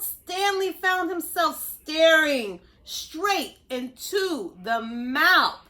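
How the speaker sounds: ordinary delivery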